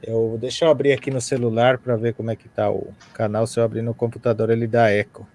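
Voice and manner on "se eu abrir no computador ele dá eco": normal talking voice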